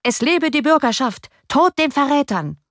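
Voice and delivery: high crowd cheer and cry; full-throated shout. A narrator's reading. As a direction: shout it at the top of your lungs. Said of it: "schrie es aus vollem Hals".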